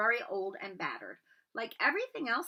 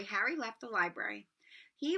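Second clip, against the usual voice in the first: usual speaking voice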